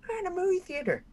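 high, half-laughing mock outrage; mock indignant complaint. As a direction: ham up being wronged, laughing as you go. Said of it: "And I'm going".

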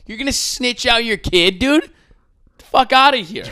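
high, loud disbelief; incredulous rant. Real, not acted. Podcaster ranting at snitching parents, high and loud. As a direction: explode in mocking outrage.

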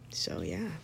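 soft whispered aside. Real, not acted. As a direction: breathe it out as a whisper. Whispered end of a thought.

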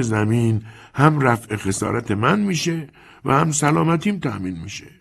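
put-on a calm, sly old lender talking down to the young man; old moneylender Chiarenza. Chiarenza lays out terms.

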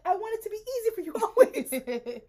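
high, loud lovestruck sigh; swooning boyfriend. Retold or imitated. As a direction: gush like a smitten teen. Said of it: "he's gonna be like".

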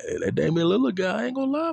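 high mock old-man voice; granddad. Granddad admitting Lillard is good, a high mock voice. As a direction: lightly imitate granddad conceding. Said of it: "he said".

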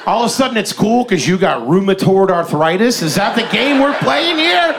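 rising to an indignant yell; mock outraged shout.

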